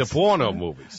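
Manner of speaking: mock Peter Griffin accent; Rhode Island accent. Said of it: "sound like Peter Griffin".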